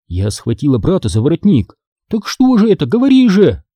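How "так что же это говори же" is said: high, insistent demand. A narrator's reading. Sister grabbing her brother by the collar, demanding. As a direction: press for an answer in a high voice. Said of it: "потребовала я".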